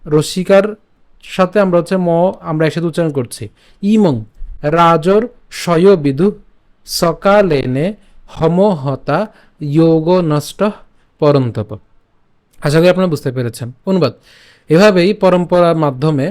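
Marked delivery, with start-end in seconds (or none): 0.0-11.7 verse read again slowly, piece by piece; restart, chunk by chunk; careful teaching pace; slow read from the start. Shown as a demonstration. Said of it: "আমি আবার প্রথম থেকে বলি".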